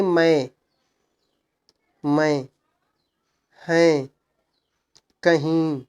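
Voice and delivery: मैं, हैं, कहीं with lightly nasal vowels; light nasal buzz. Shown as a demonstration. Teacher reading bindu examples, मैं, हैं, कहीं with light nasal buzz. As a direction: end मैं, हैं, कहीं in a slight nose hum. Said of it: "नाक से भनभनाहट हल्का सा".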